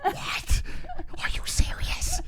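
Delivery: imitating her hushed gasps; whispered disbelief. Streamer imitating Jesse's stunned reaction, whispered. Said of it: "she's like".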